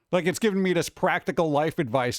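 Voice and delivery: ordinary talk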